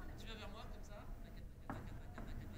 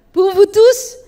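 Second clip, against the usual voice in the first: loud, raised-pitch call to the crowd; loud announcement; 7 semitones higher, 10 dB louder, much slower than usual. Pretend. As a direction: announce it to the whole hall. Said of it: "Alors, pour vous tous".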